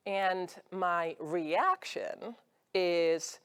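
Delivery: ordinary talk, nothing marked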